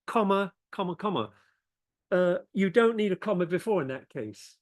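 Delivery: usual speaking voice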